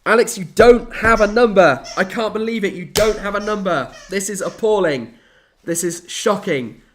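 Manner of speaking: loud, high, hammed-up indignation; mock outrage. Host to viewer without a number, mock outrage. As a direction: play up being appalled, slowing on shocking.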